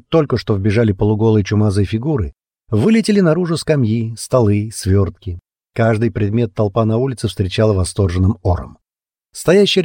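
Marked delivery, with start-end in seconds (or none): none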